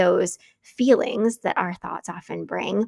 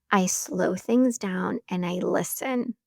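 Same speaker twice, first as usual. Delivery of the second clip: ordinary talk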